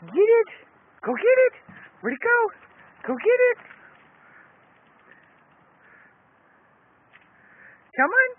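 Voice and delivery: high squeaky dog-talk voice; squeaky praising a dog. Real, not acted.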